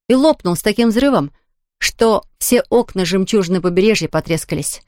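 ordinary delivery, nothing marked

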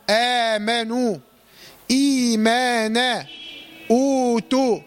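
slow recited آمنوا، إيمانا، أوتوا with long opening vowels; madd badal examples. Shown as a demonstration. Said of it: "هكذا".

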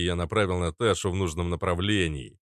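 ordinary delivery

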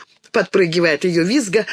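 usual speaking voice

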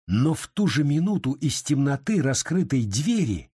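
ordinary delivery, nothing marked

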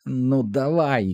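a slow taunt, shouted only lightly; mild narrated shout. A narrator's reading. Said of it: "кричить Голлі". (протяжний стриманий вигук)

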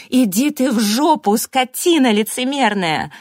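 loud, high-pitched angry shout; furious outburst. A narrator's reading. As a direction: lose your patience and explode. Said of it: "не выдержала".

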